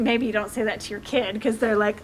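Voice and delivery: ordinary talk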